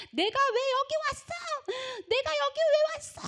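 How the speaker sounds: high-pitched acted wail of protest; aggrieved scream. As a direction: cry out at the injustice, imitating a damned woman. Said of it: "억울하다고 소리를 막 지르는".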